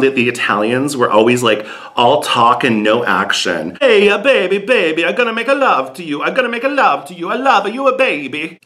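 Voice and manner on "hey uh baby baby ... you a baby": mock Italian lover. Imitating Italian seducer.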